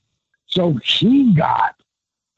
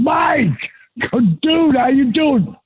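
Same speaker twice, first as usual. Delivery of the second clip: excited greeting; 11 semitones higher, slower than usual. Retold or imitated. Greeting a friend on phone.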